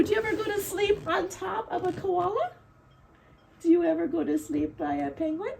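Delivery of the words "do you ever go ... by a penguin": usual speaking voice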